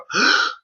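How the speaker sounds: a sudden high, frightened intake of breath; sharp gasp. Shown as a demonstration. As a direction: gasp as if the air is stolen from you. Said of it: "where you're like".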